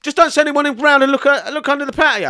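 high, loud guilty panic; imitated panicky pleading; mock terrified. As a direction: beg like a frightened suspect, hammed up.

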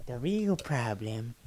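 slow, drawn-out celebrity impression; Russell Brand impression. Co-host making an off-brand joke, Russell Brand impression. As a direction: stretch it out in a put-on Brand voice. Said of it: "That's my Russell Brand".

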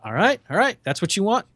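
a hint of Shatner humouring him; Shatner impression. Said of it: "Shatner goes".

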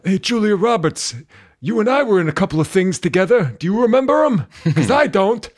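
high, far-off confused movie star; goofy forgetful actor voice. Pretend. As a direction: play a dazed old star.

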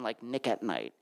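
ordinary talk

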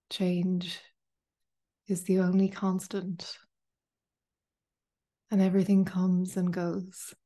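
calm meditation guide, long pauses between phrases; unhurried, spacious guided-meditation delivery. Acted. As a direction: ease listeners into stillness, phrase by phrase. Leads a guided meditation after chat.

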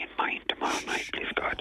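hushed phone whisper. Real, not acted. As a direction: keep it down so she can't hear. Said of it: "I have to whisper this low".

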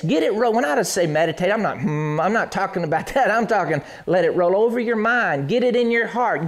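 ordinary talk

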